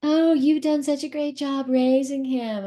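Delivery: slow, high, sugary imitation of people praising; mock the fawning compliment, stretched out; gushing admirer. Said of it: "people say to me".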